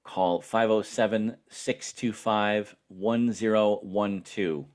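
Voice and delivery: ordinary delivery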